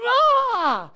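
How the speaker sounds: high pained ah cry; inner cry. Retold or imitated. Preacher showing the inner man's cry, a pained ah. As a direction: let the cry burst out. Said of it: "His inner man is just crying out".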